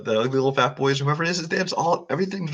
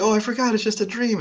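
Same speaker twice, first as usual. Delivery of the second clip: higher, airy and oblivious; mock airy Alice; 8 semitones higher than usual. Co-host mocking Alice at the end, airy and high. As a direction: toss it off like a dazed girl.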